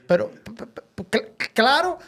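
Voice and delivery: stammering p-, p-, cl-, claro; stutter. Retold or imitated. Stutterer answering the preacher with a stammer. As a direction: trip over the first sounds.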